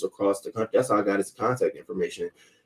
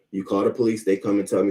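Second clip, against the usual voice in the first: ordinary talk, nothing marked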